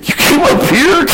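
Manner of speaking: high-pitched amazed question breaking up; incredulous. Retold or imitated. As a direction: retell disbelief, voice cracking upward. Incredulous thought, breaking into laughter.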